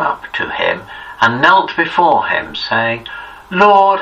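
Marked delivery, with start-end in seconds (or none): 3.5-4.0 a raised, higher cry of Lord; pleading cry. A narrator's reading. Father kneeling before Jesus cries out Lord, pleading. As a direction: call out Lord as a begging father.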